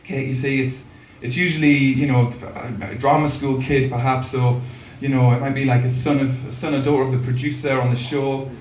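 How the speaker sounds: a hint of a mother letting a child down; lightly voiced mum. Mum explaining to child who gets on TV, lightly voiced mum. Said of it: "my mum said to me".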